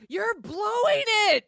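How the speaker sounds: loud, slow shout of frustration; shouted scolding at a movie character; yelling at the TV. Retold or imitated. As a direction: yell it at the screen like she's blowing it. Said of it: "I actually said out loud".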